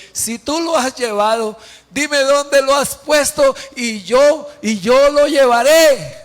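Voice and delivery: high, loud acted entreaty building in volume; declaimed, rising desperate offer; impassioned plea. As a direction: plead out loud, stretching to a forceful finish. Acts Mary pleading with the gardener.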